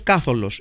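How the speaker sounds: Κάθολος stressed on the opening ΚΑ; first-syllable accent in Κάθολος. Shown as a demonstration. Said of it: "ή Καθολός ή Καθόλος".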